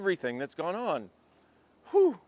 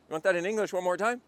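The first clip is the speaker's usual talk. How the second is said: normal talking voice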